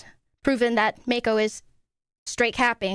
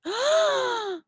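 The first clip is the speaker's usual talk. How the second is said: high, sharp mock gasp of offense; theatrical offended gasp; shocked gasp; 13 semitones higher than usual. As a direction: gasp as if deeply insulted. Mock trial, accused gasps.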